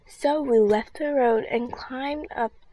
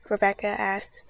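ordinary delivery

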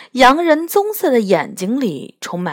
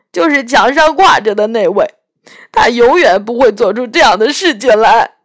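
a lightly voiced tearful faun, louder; weeping faun; 3 semitones higher, 7 dB louder, faster than usual. Faun confessing his wickedness to Lucy, tearful faun voice. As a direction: play the ashamed faun in tears.

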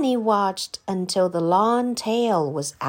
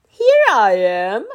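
slow, louder reveal; triumphant dinosaur; 7 dB louder than usual. A narrator's reading. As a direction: pop out proudly. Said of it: "said the dinosaur".

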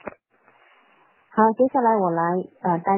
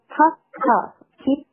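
higher-pitched, separated puff and peep; train sound imitation; 7 semitones higher, 4 dB louder than usual. Shown as a demonstration. Teacher shows the train noises, high short bursts. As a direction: make each word a toot of the engine. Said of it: "keep是模仿这个火车的声音啊".

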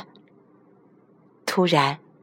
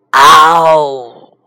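loud drawn-out roar; the lion roars at the chameleon; 4 semitones lower, 10 dB louder than usual. A narrator's reading. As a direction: let out a big lion roar.

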